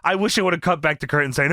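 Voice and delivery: usual speaking voice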